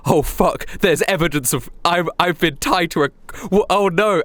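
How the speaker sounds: spiral into comic panic; mock panic. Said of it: "And I'm like".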